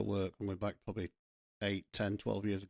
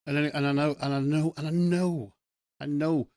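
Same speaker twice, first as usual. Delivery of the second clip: loud, high, rising insistence on KNOW; emphatic insistence; 6 semitones higher, 7 dB louder than usual. Real, not acted. Podcaster insisting to listeners that he knows, emphatic. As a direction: hammer each "I know" higher and louder than usual talk. Insisting through repeated words, building up.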